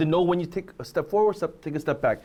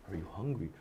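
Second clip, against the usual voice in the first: "Are you hungry?" barely breathed, far softer and lower; almost silent; 11 semitones lower, 14 dB quieter than usual. Shown as a demonstration. Teacher shows one phrase said a different way, very hushed. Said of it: "a thousand ways to say it".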